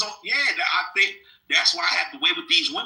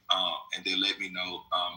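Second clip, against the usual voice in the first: normal talking voice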